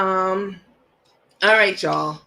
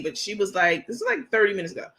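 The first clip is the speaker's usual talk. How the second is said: ordinary talk, nothing marked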